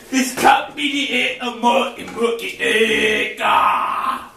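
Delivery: ordinary talk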